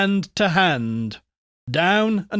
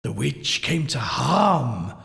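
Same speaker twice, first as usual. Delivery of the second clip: breathy whispered incantation; eerie whisper; 3 semitones lower than usual. A narrator's reading. Husband to the party in the dark, whispering. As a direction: whisper it to spook the room.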